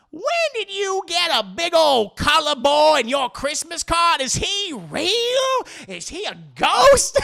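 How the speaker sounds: put-on slow, high, loud drunken disbelief; drunk caller. Drunk caller questions Leanne about her Christmas card, slow incredulous hollering. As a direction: stretch each question out, pitching it way up. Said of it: "unless I've been drinking too much".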